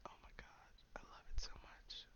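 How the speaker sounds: very soft, breathy rapture; whispered swoon. Real, not acted. Fan gushing over deep grumbly voices, hushed swoon.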